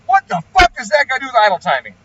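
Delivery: high, loud incredulous outburst; retold outraged comeback, voice jumping up; exasperated retort. Tuner fires back at an irrelevant argument, exasperated.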